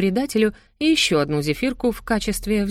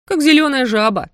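higher, louder sneering comparison; mocking jibe; 6 semitones higher, 6 dB louder than usual. A narrator's reading. Cat mocks daughter's boyfriend to her mother, sneering. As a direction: relish the insult.

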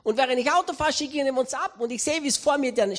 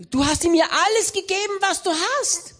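higher wife voice; Melinda Gates; 7 semitones higher than usual. Retold or imitated. Melinda reminding Bill he gave everything away, higher voice. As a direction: reproach him lightly as his wife.

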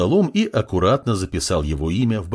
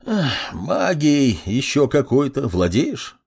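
slow, weary sigh into a question; sigh, then ask in a raised old-man voice; resigned old clerk, drawn out; 4 semitones higher, slower than usual. A narrator's reading. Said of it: "Со вздохом поинтересовался старик".

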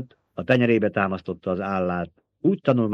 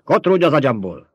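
loud, raised-pitch outburst; angry command; 4 semitones higher, 7 dB louder than usual. A narrator's reading. Old woman ordering the child out of her mind, angry outburst. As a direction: drive the intruder out with your voice.

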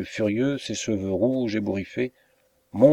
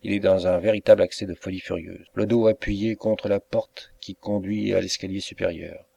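ordinary delivery

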